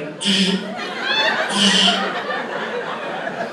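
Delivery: high unvoiced zap sound; zapper noise. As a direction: buzz like a bug zapper frying something. Sperm zapped by bug zapper.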